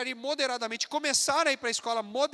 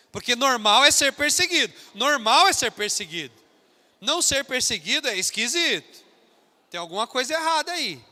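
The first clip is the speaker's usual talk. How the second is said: fiery Sunday sermon shouting to the congregation; full-force preaching; 10 dB louder than usual. Acted. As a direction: preach it hard to the whole church.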